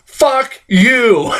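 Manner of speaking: high, drawn-out indignant curse; exaggerated outraged reaction; furious. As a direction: spit out a reenacted angry retort. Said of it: "I was so furious".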